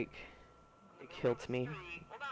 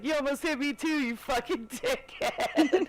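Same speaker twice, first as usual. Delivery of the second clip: mock angry yell; 10 semitones higher than usual. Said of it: "was like".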